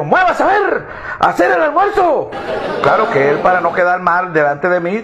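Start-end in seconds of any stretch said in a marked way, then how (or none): 0.0-2.3 high-pitched mock shout from a bossy wife; hammed-up domestic scolding; imitated bossy yell. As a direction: order him about in a raised, much higher voice. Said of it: "le pegó un grito".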